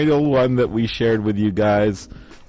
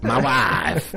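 put-on Borat accent; 4 semitones higher than usual. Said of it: "a gif of Borat with his wife".

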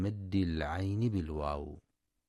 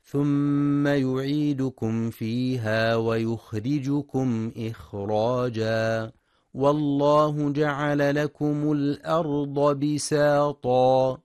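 slow, louder melodic tajweed chant; Quran recitation; 5 semitones higher, 7 dB louder, slower than usual. Acted. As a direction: chant the verse with long held vowels.

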